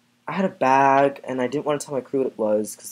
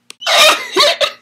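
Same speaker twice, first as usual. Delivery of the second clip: loud, high burst of laughter; squealing laugh into a breakdown; high shrieking laugh; 13 dB louder than usual. Acted.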